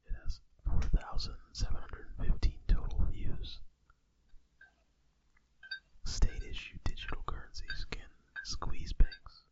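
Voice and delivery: soft whispered news read; ASMR whisper. Pretend. Podcast host reading crypto news as ASMR, whispering. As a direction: read the headlines in a hushed whisper. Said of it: "We just wanted to do Asmer".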